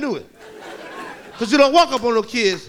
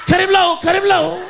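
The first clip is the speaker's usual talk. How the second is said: high, loud urgent holler; kid calling orders; 8 semitones higher, 6 dB louder than usual. Acted. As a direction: yell it like a kid directing a gang.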